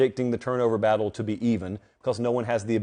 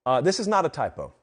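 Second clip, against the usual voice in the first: ordinary delivery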